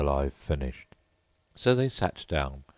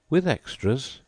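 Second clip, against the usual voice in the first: a touch of worry, higher; a little anxiously; 9 semitones higher, 4 dB louder than usual. A narrator's reading. Mock Turtle anxiously asking about extras. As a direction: ask it with mild anxiety. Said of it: "a little anxiously".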